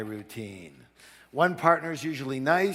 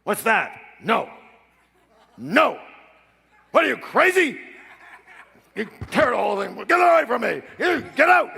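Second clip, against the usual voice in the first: much higher, loud furious yelling; angry shout; 9 semitones higher, 8 dB louder than usual. Retold or imitated. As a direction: blow up like a hard-nosed father. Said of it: "He goes".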